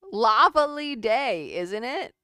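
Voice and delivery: slow, loud mock-polite dinosaur. Telling the dinosaur joke, slow mock-polite punchline. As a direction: ham up a genteel dinosaur. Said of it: "what did the dinosaur say".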